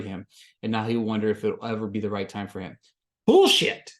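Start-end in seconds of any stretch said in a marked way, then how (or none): 3.3-3.9 very high, loud burst of disgust; outraged yell. Real, not acted. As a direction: spit it out like an outraged fan. Reacting to groom's excuse.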